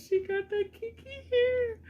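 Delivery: high, excited gush; delighted squeal. Real, not acted. Admiring the mannequin's hair, squealing with delight.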